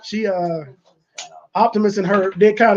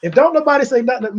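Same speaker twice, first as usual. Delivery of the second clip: usual speaking voice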